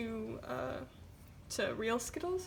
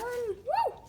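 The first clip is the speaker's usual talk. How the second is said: excited woo; 11 semitones higher than usual. Real, not acted. Skittles done, cheering.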